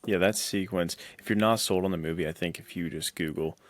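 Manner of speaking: normal talking voice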